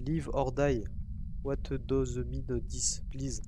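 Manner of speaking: put-on slow English in a heavy French accent. Player quizzes the ghost in English, French accent. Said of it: "Avec mon magnifique accent".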